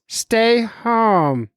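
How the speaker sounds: high, drawn-out all-caps order; mock doctor voice. Doctor friend writes in all caps, mock doctor voice. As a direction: stretch it high as a caricatured doctor. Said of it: "Then all caps, he writes".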